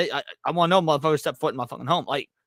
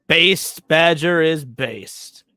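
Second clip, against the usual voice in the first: loud slow callout; 6 dB louder, much slower than usual. Real, not acted. Interjecting catchphrase.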